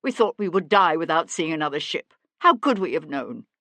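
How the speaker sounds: loud, agitated excuse; distressed self-defence; desperate pleading. A narrator's reading. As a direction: beg to be believed, forcefully. Guilty man pleads ignorance.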